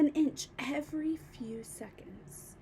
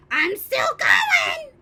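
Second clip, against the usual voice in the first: slow, high, loud crone screech; screechy old voice; 11 semitones higher, 10 dB louder, much slower than usual. A narrator's reading. Grandma shouting down from the attic, screechy. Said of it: "old screechy voice from up above".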